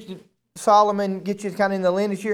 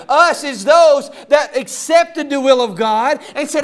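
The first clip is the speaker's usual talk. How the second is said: loud, rising sermon voice; preaching at full force; 4 semitones higher, 6 dB louder than usual. Acted. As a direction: preach it hard to the congregation.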